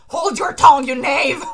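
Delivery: loud, high theatrical outburst; angry command. Acted. Manga character silencing an enemy, acted angry shout. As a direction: shout it as an imperious rebuke. Acting a furious manga retort.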